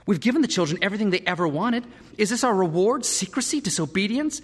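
indignant, high-pitched complaint; rising disbelief, question after question; exasperated parent. A narrator's reading. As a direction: protest loudly as a wronged parent.

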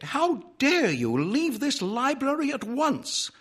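high-pitched indignant order to leave; prim outrage in a high voice; outraged librarian. A narrator's reading. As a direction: order him out, scandalised.

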